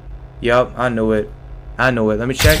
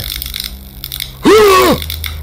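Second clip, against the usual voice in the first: long high breathy gasp; shocked gasp. Real, not acted. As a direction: gasp in horror. Power stolen by enemy.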